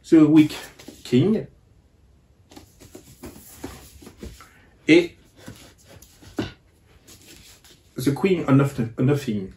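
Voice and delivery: English book titles read in a French accent; self-mocking French-accented English; franglais accent. Real, not acted. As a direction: say the English titles the French way, a little careful and halting. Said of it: "avec mon super accent franglais".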